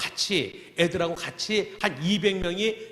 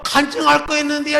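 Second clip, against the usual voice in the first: put-on child's voice; 8 semitones higher, 8 dB louder than usual. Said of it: "이러는 거야, 애가".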